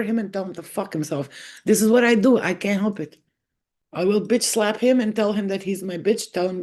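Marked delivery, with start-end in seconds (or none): none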